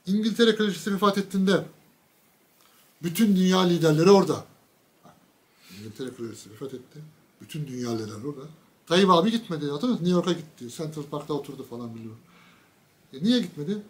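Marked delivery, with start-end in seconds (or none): 5.8-8.4 much softer, quicker repeat; hushed retelling. Real, not acted. Repeating the news to the audience, hushed. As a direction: drop the voice right down and rush it. Same line told twice.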